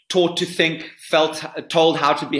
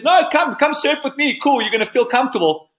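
high, bright mock invitation; squeaky, sing-song come surf with me; high mock surf instructor; 11 semitones higher, 3 dB louder than usual. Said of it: "guy with a strange South African accent and says".

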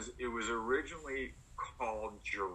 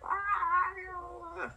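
high piercing mock scream from inside the shark head; retold scream. Actress inside a shark head, screaming mock scream. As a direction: let out a sudden, hammed-up shriek. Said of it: "she's screaming at the top of her lungs".

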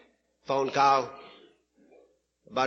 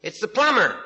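higher, raised call from outside; calling through door; 6 semitones higher, 4 dB louder than usual. Acted. Plumber answering through the closed door, raised calling voice. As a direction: call out to whoever is inside. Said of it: "The voice came back and says".

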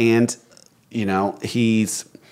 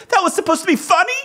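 high, fast incredulous question; wife amazed her husband made a joke; amazed disbelief; 4 dB louder, faster than usual. Retold or imitated. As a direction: squeak it out in happy disbelief. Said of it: "she got the oddest look on her face".

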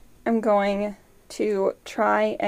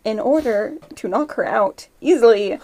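ordinary delivery

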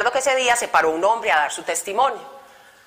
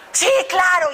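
a much higher, brighter yes; overjoyed yes; 10 semitones higher than usual. Retold or imitated. Brother Saulo delighted she finally said yes, much higher. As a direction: burst out delighted. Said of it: "y él así súper feliz".

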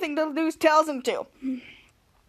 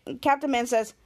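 usual speaking voice

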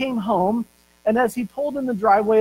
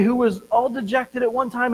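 ordinary talk

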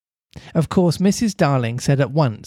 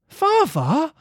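high shocked cry of Father!; a girl gasping at her father, scandalised; 13 semitones higher than usual. A narrator's reading. Wendy reproaching her father, shocked cry. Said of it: "Wendy cried, shocked".

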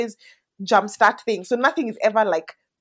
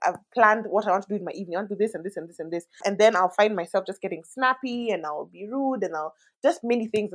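usual speaking voice

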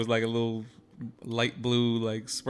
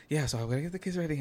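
quick, higher, softer imitation of partner; too-fast morning chatter; 4 semitones higher, 5 dB quieter, faster than usual. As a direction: rattle it off lightly without a break. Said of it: "Yo, please slow down".

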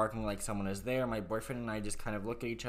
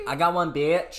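louder, higher, crowing it out; triumphant playful yell; loud taunt; 6 semitones higher, 11 dB louder, slower than usual. Acted. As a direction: shout it like a gloating win. Repeats line, much louder.

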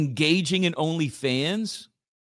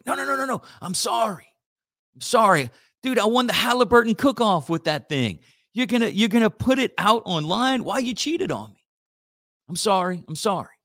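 pleading apology; 5 semitones higher than usual. Acted. Acted begging cook.

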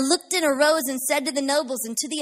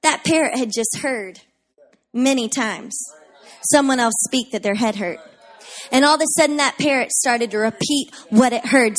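ordinary talk, nothing marked

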